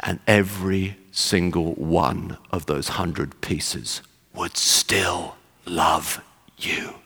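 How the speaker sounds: soft, low, very slow, fading to a whisper; tender retold reply, almost whispered at the end; hushed declaration of love. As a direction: let each word land, down to a whisper. Quoting Wilkerson's answer to knife threat.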